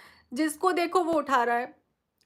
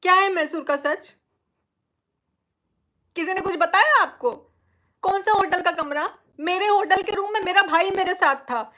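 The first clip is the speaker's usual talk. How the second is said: indignant; 3 semitones higher, 4 dB louder than usual. Real, not acted. Angrily rebutting a rumour.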